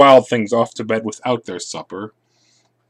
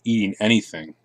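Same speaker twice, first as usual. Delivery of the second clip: ordinary delivery, nothing marked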